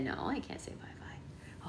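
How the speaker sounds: put-on slow whisper for the baby. Mom voicing her baby refusing to say bye-bye, pretend whisper. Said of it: "She said".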